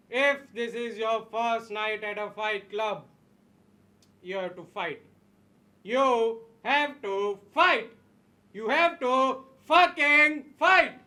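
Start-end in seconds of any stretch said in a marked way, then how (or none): 4.2-11.0 building to a shout. Acted. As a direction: push it harder on each repeat. Repeats command, building each time.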